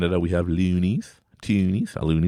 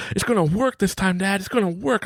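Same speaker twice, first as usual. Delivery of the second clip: high, fervent imitation of a son; soulful excited child. Son hopes dad's magic works, high fervent imitation. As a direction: pour your whole soul into a high kid voice. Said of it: "he poured his whole soul".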